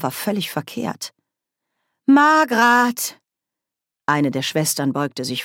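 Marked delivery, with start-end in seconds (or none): 2.1-3.2 higher, louder alarmed call. Acted. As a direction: shout her name in alarm. Nanny calling out in danger.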